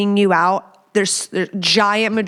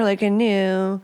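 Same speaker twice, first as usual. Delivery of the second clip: ordinary talk, nothing marked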